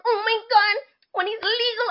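high, breathy mock swoon; mocking women waiting for a boy star; mock swooning woman. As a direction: gush like a leering grown woman.

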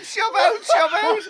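very high-pitched yell, close to laughter; coach's shout. Retold or imitated. Coach still ordering his lad out wide, hammed-up shout. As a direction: imitate the coach's repeated holler. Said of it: "He's shouting to his young lad".

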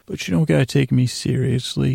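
ordinary talk

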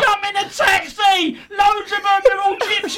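high, laughing urgent shout; hammed-up urgent warning; laughing mock warning. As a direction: fake urgency through laughter. Retold fake warning shout.

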